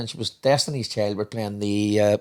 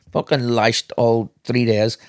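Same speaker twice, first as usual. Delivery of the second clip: usual speaking voice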